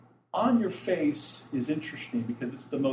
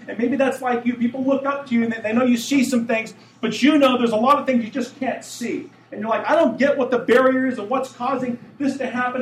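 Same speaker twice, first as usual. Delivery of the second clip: louder, higher, driving sermon voice; full-force preaching; 9 semitones higher, 9 dB louder than usual. Acted. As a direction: preach it out to the whole room.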